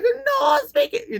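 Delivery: very high, slow mock broken-English accent with a laugh. Laughing while faking no English, very high and slow. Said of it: "stereotypical accent".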